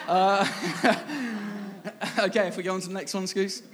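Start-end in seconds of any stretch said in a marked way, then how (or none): 0.5-2.1 real laugh. Real, not acted. Laughs at a heckler.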